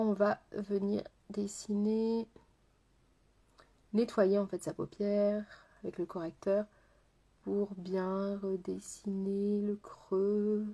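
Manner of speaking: a hint of a hypnotist, drawn out; hypnotic voice. Shown as a demonstration. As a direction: talk like you're hypnotising them. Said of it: "J'adore parler comme ça, on dirait que je suis en train de vous hypnotiser".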